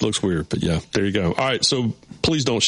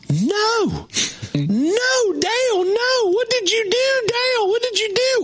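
retold scream; much slower than usual. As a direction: shriek it like a heartbroken fan at the fence. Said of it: "this woman started screaming".

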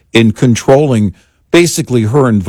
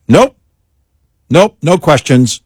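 dismissive refusal; 5 semitones higher than usual. Retold or imitated. Voices handlers refusing all questions.

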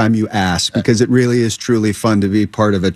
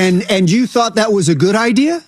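high, incredulous challenge; pointed question, pitched up throughout; Ben Johnson; 8 semitones higher than usual. Acted. Coach as stepfather questioning the idea, challenging. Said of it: "it looks like he's saying".

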